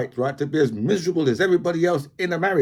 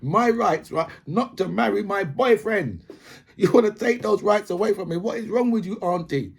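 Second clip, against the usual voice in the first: higher-pitched, exasperated nephew voice; indignant nephew; 7 semitones higher than usual. Retold or imitated. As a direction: plead with the auntie in disbelief. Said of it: "But it's just like".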